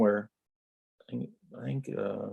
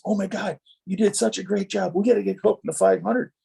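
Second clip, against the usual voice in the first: quoting a thrilled teammate, pitched much higher; excited retelling; 8 semitones higher than usual. Retold or imitated. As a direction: gush about the great job like an excited buddy. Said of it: "he comes over and he goes".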